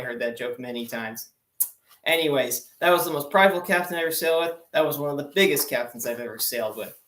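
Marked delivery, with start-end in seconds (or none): 2.8-4.6 "that was the most prideful captain" rattled off fast; rushed repeat. Shown as a demonstration. Repeating the captain line to the audience, hurried.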